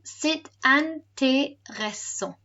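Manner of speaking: slow "C'est intéressant", each syllable separated; syllable-by-syllable model. Shown as a demonstration. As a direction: stretch out intéressant, é as "ay". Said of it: "it makes an A sound".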